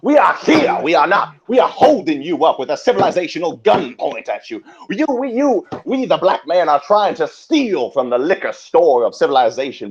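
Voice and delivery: high, loud sermon cry; hammed-up pulpit shouting; fiery preaching. Preaching at full force.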